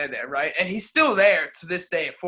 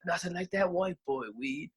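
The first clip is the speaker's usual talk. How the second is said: lightly voiced homeless man; 8 dB quieter than usual. Homeless man praising the students' weed, soft. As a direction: murmur it like a satisfied smoker.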